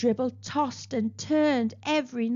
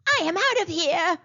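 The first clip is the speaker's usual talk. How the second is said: mild yowl; 7 semitones higher than usual. Acted. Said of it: "Flossie yowled".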